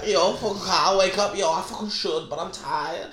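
Kyle. Acted. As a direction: imitate your tired friend muddling through thoughts. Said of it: "That's the best impression".

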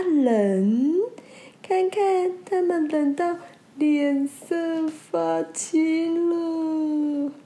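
slow, sneaky, drawn-out villain; sly lantern fish. Acted. Lantern fish spreading the rumour, slow sly voice. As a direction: savour the trick like a schemer.

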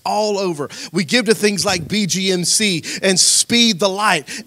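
loud, high, rousing sermon; rally the congregation with rising fervor; full-force preaching. Real, not acted.

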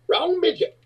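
high squeaky disclaimer; panicked little guy. Retold or imitated. To the big guy asking for money, a high squeaky denial. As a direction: squeak it high, dodging the blame. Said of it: "and he went".

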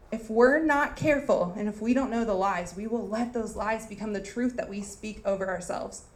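ordinary delivery

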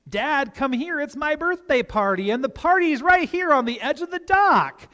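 high-pitched imitation of a boy luring Dad over; wheedling excited kid. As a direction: pitch it up like an eager, scheming child. Voices sons luring him over.

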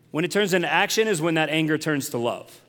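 ordinary delivery

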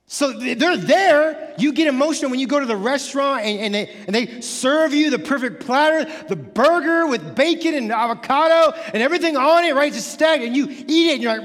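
full-force sermon; fired-up preaching. Acted. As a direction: drive it home to the congregation.

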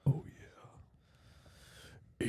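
breathy close whisper; right in your ear. Real, not acted. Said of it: "let me whisper in your ear".

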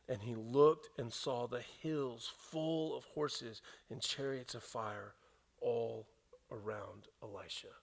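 ordinary delivery

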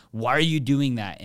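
a sharp, loud accusing why. Retold or imitated. A frustrated colleague accuses a teammate, raising voice. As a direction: snap the question out loud and accusing. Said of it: "freaking out and raising your voice".

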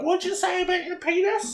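high-pitched imitation of a scandalised mum; mock outraged mum. Friend playing the mum walking in on the podcast, mock shocked. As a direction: pitch it way up as a shocked mother demanding answers. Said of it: "your mum will come in and be like".

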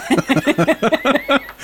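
a bright real laugh. Real, not acted. Player laughs at the brush joke, bright real laugh. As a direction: let the laugh burst out.

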